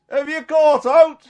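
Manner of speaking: high, slow shout across water; retold long-distance holler; calling out. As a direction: call it loudly from the shore. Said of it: "he kinda shouts".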